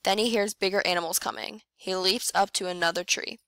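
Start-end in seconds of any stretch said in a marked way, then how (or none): none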